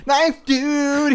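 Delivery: high, far-off announcer cry; mock announcer voice; hype announcer. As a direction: call it out like a ring announcer.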